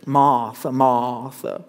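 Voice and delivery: put-on low Cajun-accented call, R dropped from Martha; Doctor Stevens. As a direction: imitate a Southern Louisiana drawl calling a name. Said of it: "Southern Louisianan Cajun".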